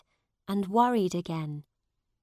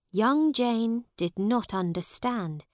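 ordinary delivery, nothing marked

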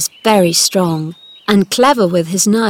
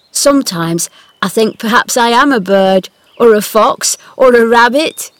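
put-on playful boy voice; 3 semitones higher, 7 dB louder than usual.

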